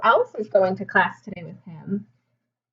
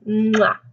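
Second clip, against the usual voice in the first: loud smacking kiss; kiss sound; 5 dB louder than usual. Acted. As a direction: plant a big smooch on the palm. Mother kisses palm in story.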